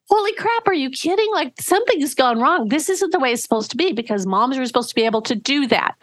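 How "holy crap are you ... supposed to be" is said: high-pitched startled inner voice; alarmed mock panic. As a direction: voice the mother's shocked alarm, pitched up. Mother's alarmed inner reaction.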